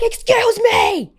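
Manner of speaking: very high, loud, drawn-out shriek; top-of-lungs yell. Retold or imitated. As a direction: scream it slowly to make her look at you. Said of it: "I yelled at the top of my lungs".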